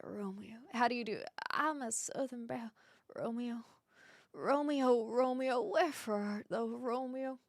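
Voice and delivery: softly tried Southern belle accent. Shown as a demonstration. Streamer trying the balcony scene for viewers, tentative Southern accent. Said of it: "where I can't really do a, an accent, but let's try".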